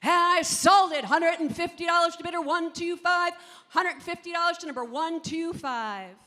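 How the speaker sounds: loud, ringing declaration of the sale; sale announcement. Acted. Auctioneer announcing the winning bidder, loud ringing call.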